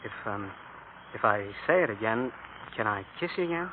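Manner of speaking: hushed shy voice; shy and soft. Acted. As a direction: ask softly, almost whispering. Shy lovers whispering.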